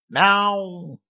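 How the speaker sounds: high shrill cat yowl; wailing cat meow. Acted. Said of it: "завопил кот".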